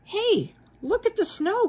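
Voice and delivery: high, bright call of delight; excited cry. Acted. Children spotting snow, excited high cry. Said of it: "cried the children".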